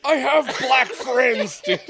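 high, loud and drawn out; mock-indignant protest. Teased man quoting his defense against racism jokes, mock-indignant protest. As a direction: plead it loudly, half laughing.

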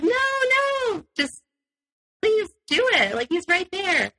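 high eager pleading; high supportive plea; encouraging plea. Retold or imitated. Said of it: "being supportive and encouraging".

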